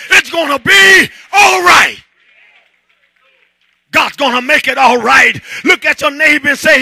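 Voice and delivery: loud, climbing sermon shout; preaching at full force. Acted. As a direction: drive it home to the congregation.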